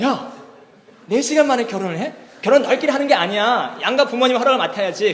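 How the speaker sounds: can't believe it, scold them; flabbergasted scolding. Retold or imitated. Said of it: "목사님 너무 황당한 거예요".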